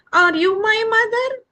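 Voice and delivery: high, loud little chick voice; eager chick. Acted. Chick runs to the hen and asks, high and eager.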